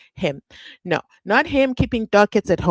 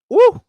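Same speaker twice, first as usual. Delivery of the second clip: much higher, louder cheer; excited woo; 12 dB louder than usual. Real, not acted. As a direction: let out a hype whoop. Hyped cheer.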